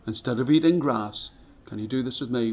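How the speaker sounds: plain reading voice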